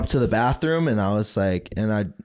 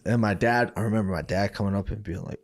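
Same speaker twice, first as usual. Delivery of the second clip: usual speaking voice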